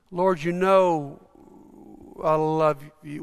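slow and halting, with long gaps; fumbling reply. Retold or imitated. Peter answers Jesus's hard question, fumbling. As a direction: falter through the answer, lightly acted. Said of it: "Peter's fumbling with this".

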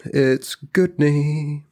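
high, slow drawn-out name; drawn-out announcer intro. Acted. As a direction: stretch the name out like a host. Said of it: "everybody's favorite dungeon troll".

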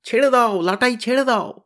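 high, loud shout upward. Acted. The boys shout up to Tenida to let go, shouting. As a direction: yell up urgently. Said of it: "আমরা তিনজন একসঙ্গে চেঁচিয়ে উঠলুম".

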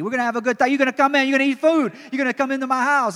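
loud, high hammed-up Italian accent. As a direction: play an excitable Italian host, loud and high. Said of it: "That's my Italian accent".

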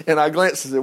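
ordinary talk

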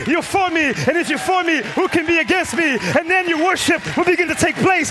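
loud, high, driving preacher's cry; preaching at full force. Acted. Preacher urging the congregation to tell God they're angry, preached loud and high. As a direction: lift the room, preach it hard and loud. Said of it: "I feel like preaching today".

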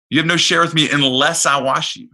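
Jesus' words to Peter, preached with full force; preaching emphasis. Acted. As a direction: proclaim it to the congregation. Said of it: "repeated line, preached loudly".

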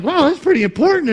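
very high, loud, quick wow; amazed realization. Retold or imitated. Preacher re-enacting his reaction to the word, amazed. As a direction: blurt out how much it matters. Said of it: "to me, I'm like".

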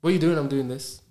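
quick, sure question and answer; confident. Acted. Someone sure of their direction answering questions, confidently. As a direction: answer firmly without a pause for thought. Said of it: "Now you start moving with confidence".

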